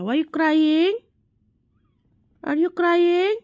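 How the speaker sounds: sing-song rise at the end; cooing question. Real, not acted. Checking on the dreaming sleeper.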